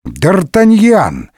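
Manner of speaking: high, drawn-out glad cry; joyful exclamation. A narrator's reading. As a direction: hail an old friend with delight. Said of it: "радостно воскликнул он". (радостное восклицание)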